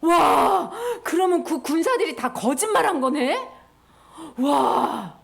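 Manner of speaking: loud, gasping amazement; acted shock with big loud wows; astonished disciples. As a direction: gasp and exclaim in wonder. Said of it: "제자들이 깜짝 놀랐어".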